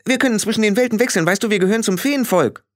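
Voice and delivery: high, quick fairy-folk voice; patient little fairy man. A narrator's reading. Billy explains world-hopping to Frau Grad, patiently. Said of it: "sagte er geduldig".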